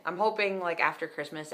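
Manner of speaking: usual speaking voice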